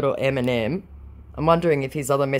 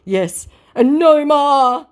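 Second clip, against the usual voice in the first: high, loud, drawn-out vow; hammed-up scammer promise; mock declaration; 12 semitones higher, 8 dB louder, slower than usual. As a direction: swear it slowly and theatrically. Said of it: "he declares".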